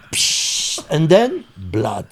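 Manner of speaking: usual speaking voice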